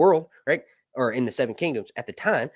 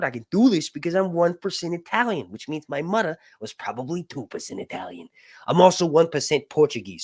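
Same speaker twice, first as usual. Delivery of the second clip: ordinary talk, nothing marked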